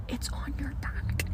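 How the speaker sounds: hushed, breathy whisper through the glass; a secret whisper across the store. Retold or imitated. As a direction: mouth it quietly so only she hears. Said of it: "And he goes like this".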